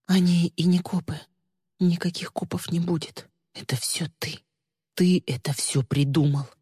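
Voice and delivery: hushed, halting accusation; stunned realisation. A narrator's reading. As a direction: accuse him, stunned and near tears. (потрясённо, с обидой)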